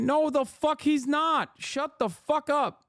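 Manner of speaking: slow exasperated put-down; angry dismissal. Real, not acted. Angry dismissal of chat claim.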